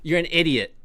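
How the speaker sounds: loud frustrated jab; angry outburst. Real, not acted. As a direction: snap it out in real exasperation. Blurts insult at commenter.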